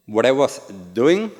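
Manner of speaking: normal talking voice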